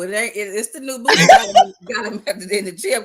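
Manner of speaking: laughing through the words; can't keep the laugh in while teasing. Real, not acted. Teasing about the new boo, laughing.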